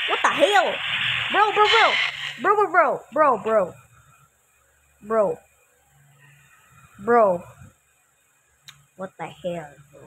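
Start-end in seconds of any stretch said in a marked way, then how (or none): 0.1-7.4 panicked shouting. Real, not acted. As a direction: yell it in a fright. Panicking at scary scene.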